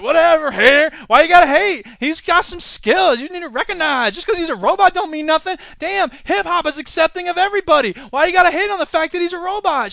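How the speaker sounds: high, loud, scoffing defence; defensive fan. Retold or imitated. Mock hip-hop fan defending the robot rapper loudly. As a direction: brush off the hater, loudly. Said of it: "And you're like".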